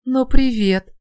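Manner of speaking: high, loud gritted-teeth greeting; through gritted teeth. Acted. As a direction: spit out the hello through teeth. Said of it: "процедила сквозь зубы Маша".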